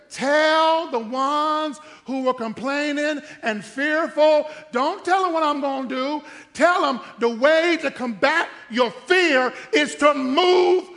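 loud, high, slow decree; God's command. Retold or imitated. God telling Moses to move forward, loud. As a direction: proclaim it to the fearful. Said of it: "He says".

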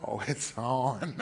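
soft, slow, low threat; quiet menace. Retold or imitated. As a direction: murmur the challenge to yourself. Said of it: "had this look in my eye like".

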